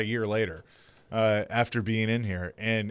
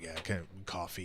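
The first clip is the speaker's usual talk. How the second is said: terse, much softer request; barely talking; 5 semitones lower, 16 dB quieter than usual. Retold or imitated. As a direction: drop it out with no effort at all. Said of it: "just talking like that".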